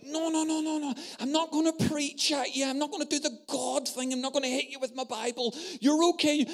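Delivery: high, soft, hurried backpedalling; calm her down in a rush; imitating a friend's fluttery no-no-no; flustered reassurance. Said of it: "Septa's like".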